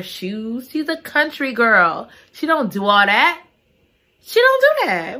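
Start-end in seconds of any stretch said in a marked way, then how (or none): 4.2-5.2 high, sassy put-down; high sassy dismissal. Real, not acted. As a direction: toss it off with attitude. Country girl attitude.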